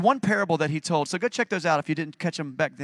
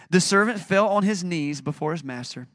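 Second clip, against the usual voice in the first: ordinary talk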